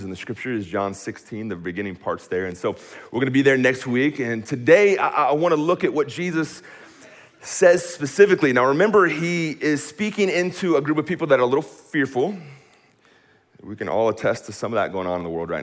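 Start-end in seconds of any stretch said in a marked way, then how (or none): 3.1-12.4 raised, higher preacher's voice; impassioned preaching. Real, not acted. Preacher introducing what Jesus says to fearful people, impassioned preaching. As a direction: lift it up and drive it to the room.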